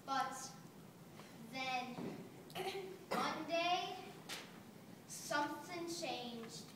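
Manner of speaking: low, soft, halting turn; somber. Acted. Blanket tells how life changed one day, somber. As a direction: drop softly into a somber note. Turning point in the blanket's story.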